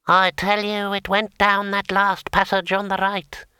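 high, hissing voice like scraping steel; hissing sword voice. A narrator's reading. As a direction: hiss it like a blade on stone. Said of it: "hissed Kring".

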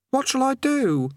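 put-on high young boy's voice; lightly high-pitched youth voice; boy Eric. Eric despairing.

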